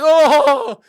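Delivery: sudden high, loud yelp of disgust; shocked yelp. Retold or imitated. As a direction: jump back from the mess with a cry. Shit clump plops next to toilet.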